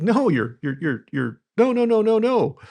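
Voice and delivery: hammed-up panic, yelping no again and again; mock panic. Owner cutting off an employee, high mock panic. Retold alarm at teammate.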